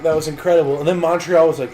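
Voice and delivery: usual speaking voice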